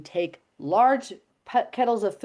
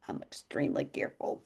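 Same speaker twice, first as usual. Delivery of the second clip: whispered, much lower and softer; 12 semitones lower, 10 dB quieter than usual. Real, not acted. Host confides her caution to the callers in a whisper. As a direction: whisper it like a secret.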